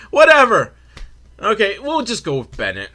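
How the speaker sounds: high, loud burst of giving up; exasperated. Real, not acted. Host fumbling the fighter's name, loud exasperated dismissal. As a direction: throw up your hands loudly.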